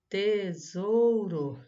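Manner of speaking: "Tesouro" loudly stretched as te-sou-ro; slow teacher's syllable split of "Tesouro". Shown as a demonstration. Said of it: "Separe em sílabas as palavras". ("Tesouro" devagar e forte, sílaba por sílaba)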